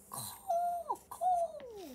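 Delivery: high squawking caw; crow caw. Acted. Crow sound in storybook.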